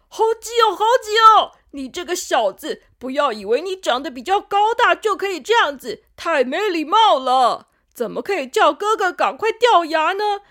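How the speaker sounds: put-on high, loud cross kid voice; unhappy scolding. A narrator's reading. Front tooth scolds pushy younger tooth, cross and loud. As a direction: scold the rude little brother. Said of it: "小佑不高兴地说".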